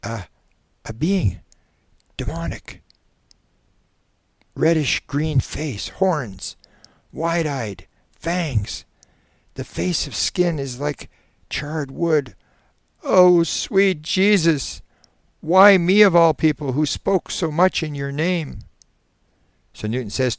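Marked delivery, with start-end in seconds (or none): 0.0-12.4 high, halting, horrified description; hushed dread. Acted. Subject describes the demon he sees, hushed dread. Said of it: "The man is whispering, first". 13.0-18.6 high, loud, anguished plea; loud lament. Acted. Preacher in regression pleads to Jesus, loudly. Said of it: "and then loudly".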